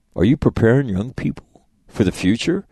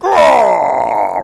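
imitating producer yelling "Raw!" loudly; excited shout; 5 semitones higher, 6 dB louder than usual. Producer cheering the plan to skip the live broadcast, shouted. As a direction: bark it like a hyped-up producer, higher and loud. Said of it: "So he's like".

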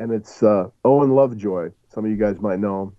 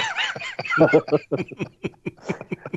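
high-pitched burst of real laughter; 5 dB quieter than usual. Real, not acted. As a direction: crack up at the memory. Laughing at own story.

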